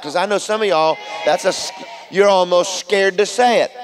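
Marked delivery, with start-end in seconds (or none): none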